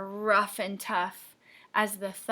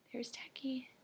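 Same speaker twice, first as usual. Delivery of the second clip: put-on hushed whisper to the kids; 15 dB quieter than usual. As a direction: lean in and whisper it. Reader points to the picture.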